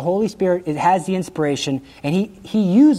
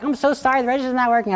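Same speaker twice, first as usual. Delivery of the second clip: lightly voiced apologetic cashier, pitched higher; 7 semitones higher, faster than usual. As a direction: play the sorry cashier, high and polite.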